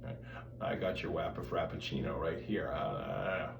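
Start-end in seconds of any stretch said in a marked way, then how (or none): 0.6-2.7 low, soft creaky fry voice; low barista in vocal fry; glottal fry. Shown as a demonstration. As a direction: rattle it out like a sleepy barista. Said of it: "what we call a glottal fry".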